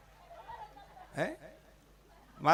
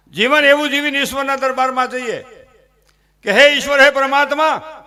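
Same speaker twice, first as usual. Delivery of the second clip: loud, rising preacher's appeal to God; a preacher calling the crowd to a life worthy before God; fervent preaching; 4 semitones higher, 9 dB louder than usual. Acted. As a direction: build it up into an impassioned plea to God. Preaching climax.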